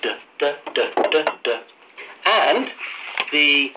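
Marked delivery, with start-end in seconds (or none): 0.0-1.6 short repeated DUH, the sound of D; letter sound drill. Shown as a demonstration. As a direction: say "duh" low, in separate little bursts. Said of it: "You've learned the sound duh".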